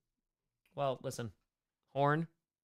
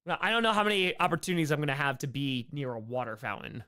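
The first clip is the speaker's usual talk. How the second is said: normal talking voice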